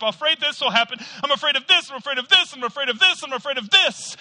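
high, loud, anxious listing; fearful excuses. Retold or imitated. Preacher voicing a fearful person listing fears, loud and high. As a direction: pile up fears in a rush. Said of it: "This is how fear works".

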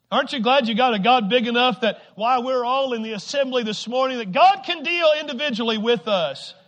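loud, rousing sermon delivery; full-force preaching. Real, not acted. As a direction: preach it out to the whole congregation.

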